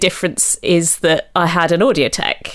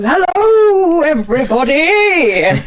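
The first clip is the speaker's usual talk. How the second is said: booming, slow, high Viking greeting; 9 semitones higher, 4 dB louder, slower than usual. Shown as a demonstration. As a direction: hail the school kids like a Viking. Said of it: "it's a Viking voice".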